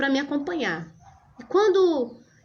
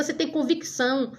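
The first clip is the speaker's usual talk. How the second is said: ordinary talk, nothing marked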